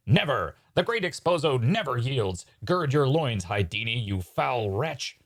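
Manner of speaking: grandiose, melodramatic defiance; defiant magician. Acted. Exposo refusing to yield to Hydini, melodramatic defiance. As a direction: declaim like a wounded stage villain, slowing at the end. Acts Exposo defying rival.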